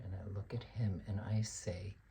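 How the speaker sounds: a seething unvoiced whisper, held in; whispered aside. Teacher whispering his rising anger before snapping at the student. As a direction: whisper it through barely contained anger.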